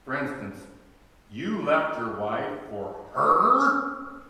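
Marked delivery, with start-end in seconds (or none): 1.3-4.2 HER hit loud and drawn out, rising; slow disbelief, punch the pronoun HER; incredulous stress on HER. Retold or imitated. People asking about an affair, incredulous stress on HER. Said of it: "the emphasis on the pronoun".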